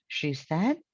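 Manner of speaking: usual speaking voice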